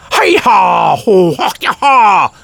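high, loud, drawn-out karate cries; hammed-up fighting shouts; embarrassing martial-arts yells. Doctor chops the air in a fighting stance, silly martial-arts yells. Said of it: "yelled some embarrassing".

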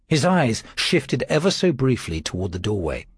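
plain reading voice

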